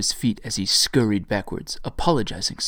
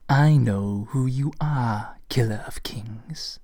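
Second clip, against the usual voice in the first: slow menacing whisper; chilling whisper; slower than usual. A narrator's reading. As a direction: let it fade to a cold whisper. Pale woman's chilling reveal.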